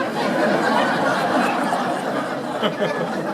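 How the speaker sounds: airy unvoiced laughter; breathy laugh. Real, not acted. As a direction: chuckle under the breath. Laughs after punchline.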